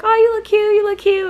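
high, loud gushing compliments; gushing relatives. Retold or imitated. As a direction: squeal it like excited aunties. Everyone gushing over her outfit.

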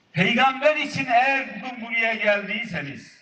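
normal talking voice

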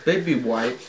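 usual speaking voice

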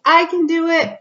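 put-on little boy voice. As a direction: pipe up like a proud little boy. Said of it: "The little boy says".

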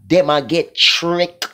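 ordinary talk

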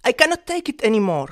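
loud, fast fed-up cry. Acted. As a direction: blurt it out, at the end of your rope. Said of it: "Donkey cried".

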